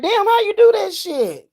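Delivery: high-pitched amazed exclamation; mock amazement. Client amazed after the exorcism, high-pitched surprise. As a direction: play the stunned client. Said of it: "People be like".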